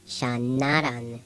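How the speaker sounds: shanaran with a plain N, no NG; wrong plain N. Shown as a demonstration. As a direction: slowly show shanaran with just an N. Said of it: "It's not pronounced".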